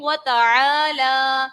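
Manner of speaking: slow وَتَعَالَى with the لا drawn long; stretched lam. Shown as a demonstration. Teacher asking students to listen, stretching the لام. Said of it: "دیکھیں پڑھ کیا رہی ہوں".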